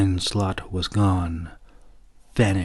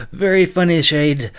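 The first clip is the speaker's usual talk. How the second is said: louder, higher brusque voice; impatient, raised knight voice; 6 semitones higher, 7 dB louder than usual. A narrator's reading. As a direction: brush off the joke and hurry them along. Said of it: "he growled impatiently".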